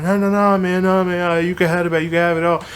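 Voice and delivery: high, strained apology on the verge of crying; scared, near tears. Retold or imitated. Caught thief apologizing to the owner, frightened and high. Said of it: "freaked out, like, about to cry".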